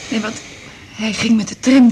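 ordinary delivery

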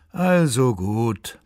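slow, resigned sigh; wearily conceding, words stretched out. A narrator's reading. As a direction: give in with a long-drawn sigh. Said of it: "seufzte Gott".

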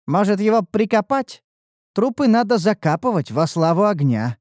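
bright, eager and playful; perky voice. Acted. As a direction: pitch it up with glee. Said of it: "предложил задорный голос".

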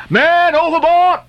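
high, slow shout of man overboard; high-pitched alarmed shout; cry of alarm. A narrator's reading. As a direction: yell it across the deck in alarm. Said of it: "cried Daggoo".

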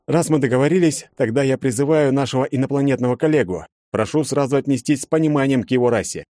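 a leader making an announcement; formal character voice. Acted. Leader introduces alien colleague.

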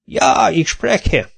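laboured, mildly grumpy answer; grumbling. A narrator's reading. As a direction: grumble it out very slowly. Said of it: "morogta Kinizsi".

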